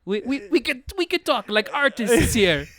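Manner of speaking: high, loud, stammering mock Romanian accent; Adrian Tofay. Spoofing the actor coaxing the girl, high stammering Romanian accent. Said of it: "If it's Adrian Tofay".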